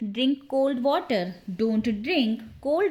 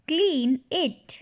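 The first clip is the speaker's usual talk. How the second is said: slow repeat; 4 semitones higher than usual. Shown as a demonstration. Slower repeat for learners. ("Clean it" धीरे, शब्द अलग-अलग)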